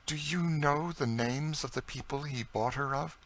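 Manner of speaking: high, hushed-urgent question; intense interest. A narrator's reading. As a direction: ask intently in a high, contained voice. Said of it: "with interest said".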